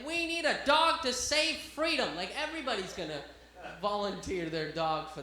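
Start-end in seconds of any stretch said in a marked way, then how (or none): 0.1-2.2 high, loud, slow proclamation; mock rallying call. Comic mimics handler recruiting a park dog, high mock-heroic call. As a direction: stretch it out like a comic call to arms.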